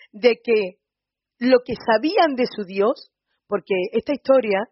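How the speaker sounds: normal talking voice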